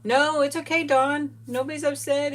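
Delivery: ordinary talk, nothing marked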